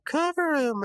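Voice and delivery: very high-pitched call on the court; high shout. Retold or imitated. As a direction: squeal the defense call. High Five's Ghost shouting in game.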